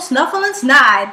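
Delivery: plain reading voice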